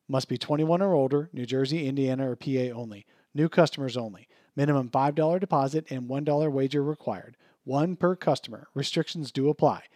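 quiet fine print read; legal disclaimer. Acted. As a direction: rattle off the small print.

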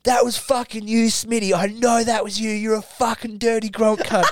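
high-pitched heated finger-pointing; mock accusation. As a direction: imitate a lad blaming his mate. Lad accusing his mate.

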